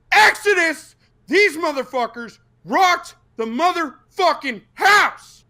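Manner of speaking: high, loud roaring praise; hyped shout. Real, not acted. Fan roaring praise for the band Exodus. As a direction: shout it like you're still in the pit.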